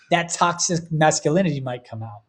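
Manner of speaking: ordinary delivery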